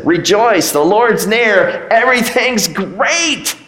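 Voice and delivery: high, slow cheerful quote; lightly voiced upbeat Paul; chipper Paul. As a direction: sing out rejoice brightly despite prison. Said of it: "Paul, writing back to these people".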